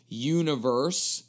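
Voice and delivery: Universe said very slowly with a rising tune. Shown as a demonstration. Repeating the word for listeners, slow rising Universe. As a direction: stretch out Universe and lift the end. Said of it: "U N I V E R S E".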